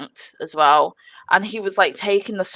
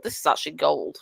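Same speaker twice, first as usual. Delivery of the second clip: inner thought; 5 semitones lower, 6 dB quieter, faster than usual. Retold or imitated. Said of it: "I thought".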